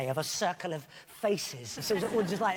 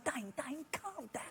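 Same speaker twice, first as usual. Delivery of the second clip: imitating Redknapp; 10 semitones higher, 10 dB quieter, slower than usual. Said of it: "Jamie Redknapp kept following me around the pitch going".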